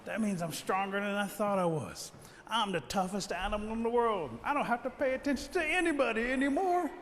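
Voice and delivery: rising, excited bragging; puffed-up little champion; boastful turtle. Acted. Turtle boasts of new strength.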